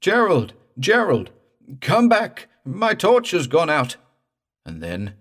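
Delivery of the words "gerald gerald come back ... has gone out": high, loud desperate calling; distant shout. A narrator's reading. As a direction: yell for help from afar.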